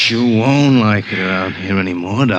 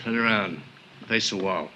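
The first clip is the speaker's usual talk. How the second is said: quiet, cold order at gunpoint; 9 dB quieter than usual. Acted. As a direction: keep it hushed and threatening. Gunman threatening in dark room.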